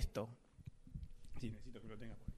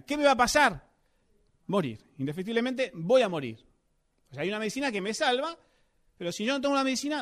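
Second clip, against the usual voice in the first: fired-up pulpit preaching, louder; 3 semitones higher, 5 dB louder than usual. Acted. Preacher driving his point home to the church, forceful preaching. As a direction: hammer the point home to the congregation.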